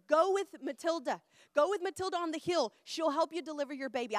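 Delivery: acting out insistent local advice; urging villagers. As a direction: send her off loudly and confidently. Villagers urging a pregnant woman.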